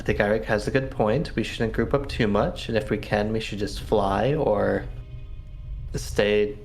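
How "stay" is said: normal talking voice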